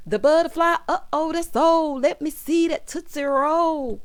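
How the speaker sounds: sing-song dance call, dragged out; playful dance hype. Retold or imitated. [name] demonstrating old dances.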